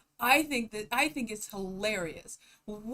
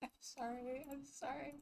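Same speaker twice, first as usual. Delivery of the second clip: breathless after laughing; 3 semitones higher, 16 dB quieter than usual. Real, not acted. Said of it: "I wipe my tears away".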